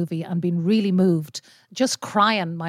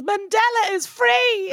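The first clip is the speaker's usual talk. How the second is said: excited shout; 13 semitones higher, slower than usual. Retold or imitated. Mother announcing Mandela's release.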